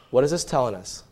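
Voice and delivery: ordinary delivery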